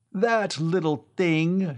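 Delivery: high, slow, contemptuous scoff; scornful cry. A narrator's reading. Shanley scorning the device on the ceiling, high and slow. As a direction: sneer at how small it is. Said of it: "Shanley cried scornfully".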